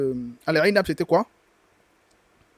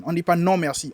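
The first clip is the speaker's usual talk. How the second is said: ordinary talk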